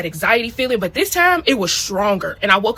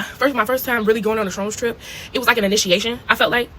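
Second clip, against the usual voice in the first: normal talking voice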